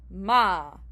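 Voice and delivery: "ma." with the vowel held long, left open; drawn-out open syllable. Shown as a demonstration. Teacher showing an open syllable, drawn-out vowel. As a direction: let "ma." keep going, nothing closing it. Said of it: "It just keeps going until you stop breathing".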